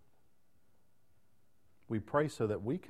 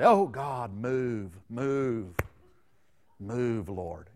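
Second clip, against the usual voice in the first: crying out prayer; 4 dB louder, much slower than usual. Retold or imitated. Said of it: "You ever heard people cry out and say".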